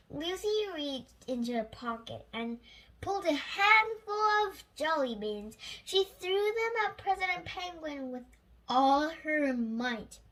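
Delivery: usual speaking voice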